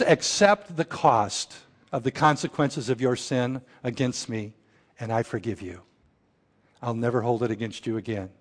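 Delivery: a quiet, earnest vow of forgiveness; sincere quiet vow. Retold or imitated.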